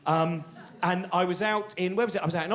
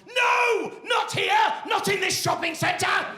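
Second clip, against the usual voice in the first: high, loud, frantic cry of no; panicked brain shout; 4 dB louder, much slower than usual. Pretend. The brain stopping you doing something in a shopping centre, shouting in alarm. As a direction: yell like your brain slamming the brakes.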